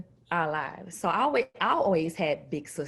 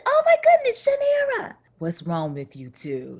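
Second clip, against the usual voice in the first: very high, loud "Oh my goodness"; high shocked squeal that drops off; voice coach's shocked greeting; 12 semitones higher, 4 dB louder than usual. Retold or imitated. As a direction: gasp it out as the startled voice coach.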